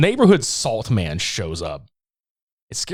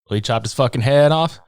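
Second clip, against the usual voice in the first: normal talking voice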